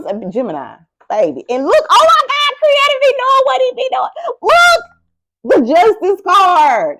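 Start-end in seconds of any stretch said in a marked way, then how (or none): 1.5-7.0 excited. Real, not acted. Thrilled at the Justice card.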